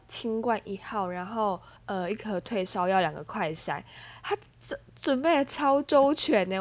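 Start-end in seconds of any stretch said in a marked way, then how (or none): 4.2-6.6 touched and gushing. Real, not acted. Moved by friend's care.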